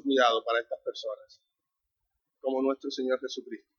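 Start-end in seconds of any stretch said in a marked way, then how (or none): none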